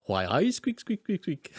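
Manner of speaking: lightly voiced rat, squeaks climbing high; a hint of a Geordie rat squeaking; squeaky rat voice. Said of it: "in a booth as a rat going".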